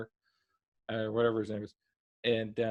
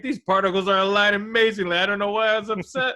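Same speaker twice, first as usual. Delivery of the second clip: gushing delight; 8 semitones higher, 6 dB louder than usual. Retold or imitated. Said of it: "and he's like".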